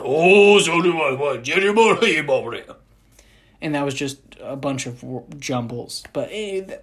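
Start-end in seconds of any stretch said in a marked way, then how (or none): 0.0-2.6 loud, mock Japanese villain jumble; hammed-up anime villain, loud; mock villain gibberish. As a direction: bark fake villain words. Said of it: "low-toned Japanese villain voice".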